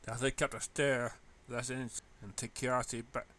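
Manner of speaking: ordinary delivery, nothing marked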